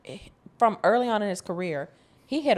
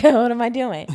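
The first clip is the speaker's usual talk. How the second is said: mock-lost, higher and louder; confused self; 6 semitones higher, 8 dB louder than usual. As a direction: play yourself baffled at the screen. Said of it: "look at the computer like".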